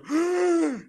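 high theatrical gasp; mock gasp. Friend hearing a promised confession, a mock gasp. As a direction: gasp in fake shock.

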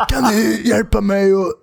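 lightly hammed-up drunk asking for help; drunk stranger. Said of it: "det är samma person".

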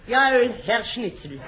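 put-on German accent. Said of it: "an excerpt from a typical quiz show".

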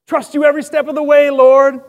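high-fiving the Lord on dry land; a hint of mock-eager cheering; pumped-up eagerness. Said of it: "we're like".

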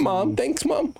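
high, sarcastic thanks to mom; mock thanks. Brothers joking about why they have no tattoos, high sarcastic thanks. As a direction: pitch it up like a whiny kid.

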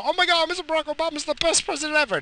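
high, fast, gushing mock fan voice. Mocking an Obama admirer in a gushing high voice. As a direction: squeal it like a starstruck fan. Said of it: "No, he's not".

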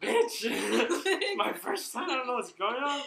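loud, high retort through laughter; laughing mock protest. First-timer answers friends saying she is taking a while, laughing protest. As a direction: exclaim it indignantly while cracking up.

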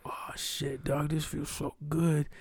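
whispered, blissed-out murmur; hazy whisper. Retold or imitated. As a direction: drop to a soft, breathy whisper. Voices his hazy pleasure.

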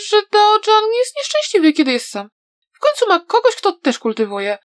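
put-on high girlish voice, half confiding; a young girl trying to sound grown up; a touch of shy girl; lightly voiced young girl. A narrator's reading. Atsing speaks about Daojan.

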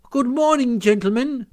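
high-pitched polite washerwoman greeting; very respectful. A narrator's reading. Mole as washerwoman greets the sentries, respectful. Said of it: "says I, very respectful".

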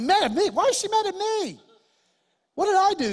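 high, loud, incredulous squeak; shocked disbelief. Retold or imitated. To the neighbor about his wife, shocked and high. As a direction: act stunned and innocent. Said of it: "I said".

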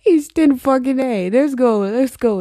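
loud, high burst that eases off; gleeful declaration. Pretend. Podcaster embracing a made-up German phrase, gleefully declaring it. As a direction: proudly proclaim your botched phrase, high and loud at first.

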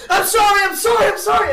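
high, loud, frantic mock panic; panicked apologies. Demon grabbed by the shirt, shrieking apologies. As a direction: squeal it out in fake terror. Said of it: "And I'm like".

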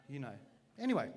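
ordinary talk

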